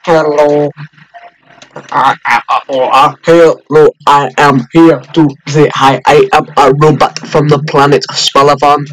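robot greeting voice; stiff, halting little robot; toy robot voice. Pretend. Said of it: "making little robots".

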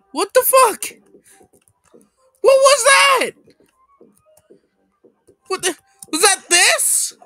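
high, loud disbelieving yells; real outrage at what just happened; shocked shout. Real, not acted. Gamer yelling in disbelief at the opponent.

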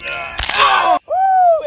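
high, loud yelp of pain; pained shout. Acted. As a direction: yell as the ankle pops. Ankle popped back.

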